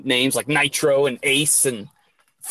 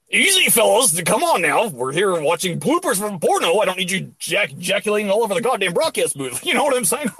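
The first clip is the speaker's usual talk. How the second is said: lightly hyped broadcast-booth voice; mock commentator; 5 semitones higher than usual. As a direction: settle down the fellas like a TV host.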